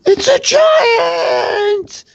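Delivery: very high, drawn-out shriek; terrified scream. Acted. Struck foe cries out at the giant, terrified scream. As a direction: scream it in panic, stretching the last word. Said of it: "and he screams".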